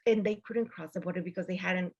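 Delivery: ordinary talk, nothing marked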